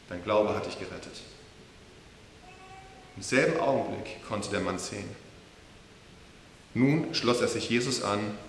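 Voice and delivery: quiet, reverent reading of the healing; hushed scripture reading. Acted. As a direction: let the moment go still. Reads the Bible passage quietly.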